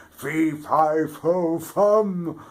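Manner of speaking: loud, high booming giant; giant's roar. A narrator's reading. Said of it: "the giant roared".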